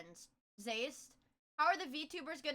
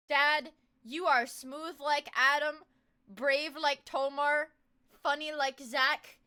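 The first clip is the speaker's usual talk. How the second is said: slow dramatic reading; 5 dB louder, slower than usual. Acted. Reading shirt slogan dramatically.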